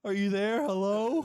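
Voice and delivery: high, loud pleading imitation; hammed-up wail into a video chat; mock tearful calling. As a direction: call out in exaggerated distress. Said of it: "he starts crying".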